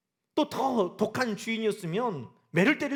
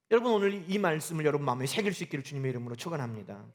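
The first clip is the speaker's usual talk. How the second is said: usual speaking voice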